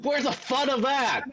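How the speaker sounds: high-pitched mock complaint; protesting character voice. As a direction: whine about spoiled fun in a put-on voice. Character complains about the order.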